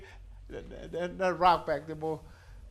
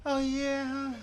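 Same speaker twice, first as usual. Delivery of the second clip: higher, soft feminine put-on; 3 semitones higher, 5 dB quieter, slower than usual. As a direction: play it all feminine, lightly. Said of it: "wanna be all feminine".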